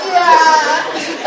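high, laughing yeah through giggles; laughing mock yeah. Preacher imitating his stubborn son, laughing high. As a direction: imitate the kid's cheeky look with a laugh.

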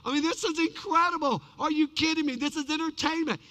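high, excited shout of amazement, easing in volume; awestruck husband. Acted. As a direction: whoop like a thrilled spectator. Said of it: "husbands are slapping their wives going".

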